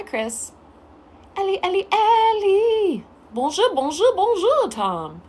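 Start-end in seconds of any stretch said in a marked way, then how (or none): 1.3-4.7 high sing-song calling of names; playful calling. Real, not acted. Greeting viewers by name in a playful sing-song. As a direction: call them out bouncing up and down.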